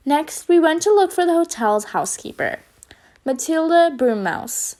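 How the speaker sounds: ordinary delivery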